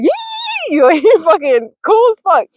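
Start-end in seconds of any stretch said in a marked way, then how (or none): 0.0-0.8 long, high-pitched holler; drawn-out imitation of a catcher's whoop; Wild Man's squeal. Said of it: "he was like".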